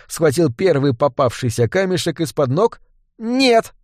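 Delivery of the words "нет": yelling. A narrator's reading. Woman warning boy too late, crying out. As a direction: cry out high in alarm. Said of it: "заорала Дарк".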